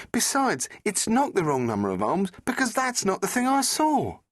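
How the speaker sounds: Rose's voice. Acted. Rose asking the Doctor about the sea, a young woman's voice.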